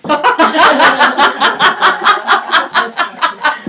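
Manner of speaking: long, loud burst of laughter; real laugh. Real, not acted. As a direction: laugh hard at your own joke. Laughs at her own joke.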